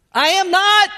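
high, very loud indignant protest; shouted denial. Acted. Peter denying Jesus out on the porch, shouting indignantly.

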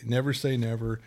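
usual speaking voice

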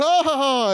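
high-pitched cry of pretend delight; mock excited squeal. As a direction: squeal like a thrilled kid on Christmas. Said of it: "We're so excited".

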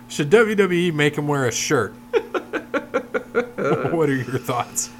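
laughing through a listener question. Real, not acted. Host reading a silly fan question, laughing. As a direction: fight off giggles while reading it out.

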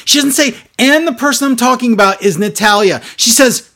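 loud, high-pitched incredulous rant; exasperated. Real, not acted. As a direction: hammer out the sensible version in exasperation. Exasperated critique of a bad promo.

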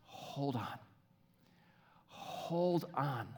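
softly voiced, hushed counsel; gentle, quiet urging. God answering someone who is praying, softly.

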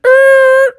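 high, loud screech; piercing cartoon skid; skid noise. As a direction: imitate brakes squealing to a halt. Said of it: "Sonic skidded to a stop".

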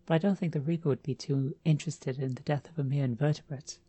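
plain reading voice

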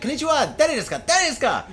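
high calling in Japanese. Retold or imitated. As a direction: call out in Japanese. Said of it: "started speaking to".

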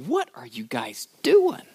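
hushed, high, baffled whisper; totally mystified. Acted. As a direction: play an onlooker who can't believe what he sees. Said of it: "is totally mystified by this".

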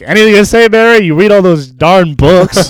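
loud, high teasing dad voice; mocking dad. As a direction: needle her like a smug father. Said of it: "He's like".

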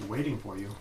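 normal talking voice

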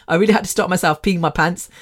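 ordinary delivery

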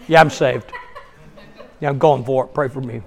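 low, quiet, glum churchgoer. Acted. Mocking gloomy Christians to the congregation, sour and subdued. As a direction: put on a joyless, trailing-off mumble. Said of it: "We tend to look like lemons".

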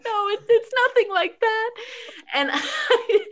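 laughing. Real, not acted. Laughing through a nervous denial.

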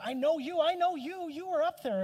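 high, loud gush of recognition; excited lady impression. Lady accosting the preacher after he spoke, loud excited imitation. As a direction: blurt it out high and loud, imitating an overeager stranger. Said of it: "some lady came up to me and said".

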